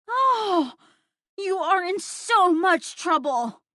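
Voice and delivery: put-on high, slow sing-song child taunting; a little boy crowing that someone's in trouble; gleeful kid. A narrator's reading. Boy tattling on the adult who ate the cake, high and gleeful.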